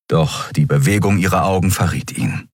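ordinary delivery

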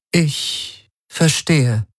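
high, very slow, controlled reply; nearly composed. A narrator's reading. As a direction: draw it out high, almost regaining composure. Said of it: "Ylanas Stimme klang beinahe so gefasst wie zuvor".

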